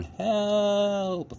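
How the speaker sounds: high, thin cry for help; desperate cry. A narrator's reading. Toy rabbit tossed in the storm, crying help. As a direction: call out weakly for help. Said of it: "thought Edward".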